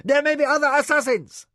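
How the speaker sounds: high, loud alarmed warning; urgent warning. A narrator's reading. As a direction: raise the alarm. Warrior warns of assassins.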